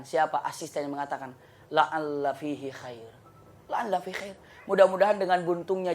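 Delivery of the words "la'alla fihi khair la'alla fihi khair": slow, soft, calm Arabic words; calm reply. Acted. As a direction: say it gently, unbothered.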